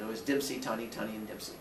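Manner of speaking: ordinary delivery, nothing marked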